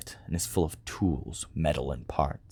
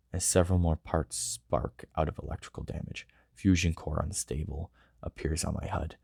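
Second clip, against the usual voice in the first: story narration; 4 dB quieter than usual. A narrator's reading. Robot narrates his body shutting down, sinking softer.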